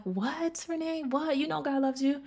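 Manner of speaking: high, soft mock disbelief; imitated puzzled listener. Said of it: "you're probably like".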